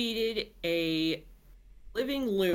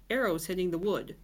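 ordinary delivery

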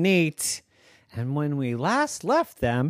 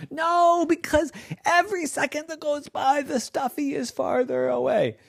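urgent insistence; 11 semitones higher, faster than usual. Acted.

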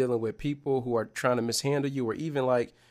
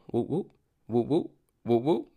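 slow, drawn-out whoop whoop of a shaking ball; Pokeball shake sound; slower than usual. Retold or imitated. Telling viewers about a Game Boy catch, slow whoop sound effect. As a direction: mimic the Pokeball wobbling, slowly. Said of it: "that's my sound effect".

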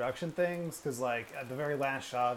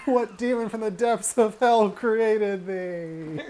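high, loud, slow theatrical declaiming; hammed-up old-English curse; mock Shakespearean horror; 9 semitones higher, 8 dB louder, much slower than usual. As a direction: proclaim it like a tragic hero. Mock-dramatic outburst about the turkey.

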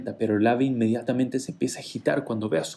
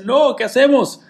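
panicked cry; 10 semitones higher, 7 dB louder than usual. Acted. Husband panics, bird sings aloud.

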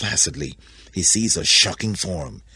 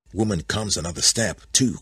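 usual speaking voice

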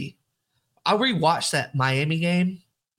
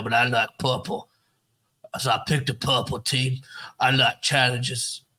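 Coach O impression. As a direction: ramble like a gruff coach at his first presser. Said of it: "what's Coach O's introductory press conference gonna be like".

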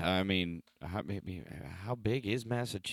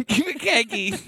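laughing Boston imitation; 8 dB louder than usual. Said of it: "very much Boston".

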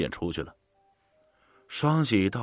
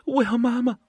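put-on high, wailing little boy; a child sobbing for mum; child crying out, much higher and louder; 4 dB louder than usual. A narrator's reading. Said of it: "孩子哭了起来".

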